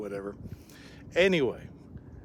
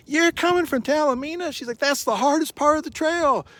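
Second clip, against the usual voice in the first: much higher, louder, different voice; wife amazed at the hiker; impressed wife; 13 semitones higher, 7 dB louder than usual. Retold or imitated. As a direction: gasp it out like an admiring stranger.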